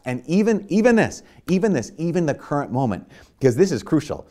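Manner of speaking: fired-up preaching. Real, not acted. Preacher urging the congregation to act, high and loud. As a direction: build to a passionate peak.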